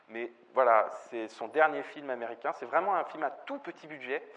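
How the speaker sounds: normal talking voice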